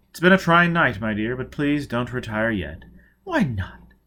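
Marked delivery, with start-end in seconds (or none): none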